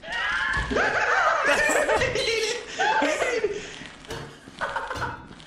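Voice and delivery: high shrieking laugh. Real, not acted. Bursting laughter.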